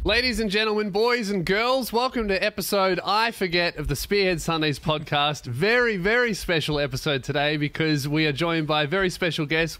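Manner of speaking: hyped podcast opening; show host intro. Real, not acted. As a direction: introduce the guest like a ringside announcer.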